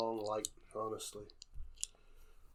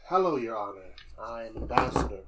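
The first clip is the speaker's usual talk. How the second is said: ambassador voice; 7 dB louder than usual. Acted. Player voicing ambassador to judge.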